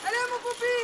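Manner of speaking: very high, cooing cheer. Real, not acted. Parent cheers a child along in the race, high-pitched encouragement. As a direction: urge the little one on in a high voice.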